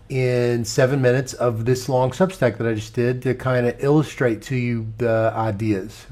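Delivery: ordinary talk, nothing marked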